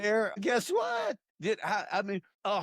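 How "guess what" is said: excited exclamation. Real, not acted. Talker to host, teasing a surprise: high excited burst. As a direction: pitch jumps way up, bursting out. Sudden excited outburst in conversation.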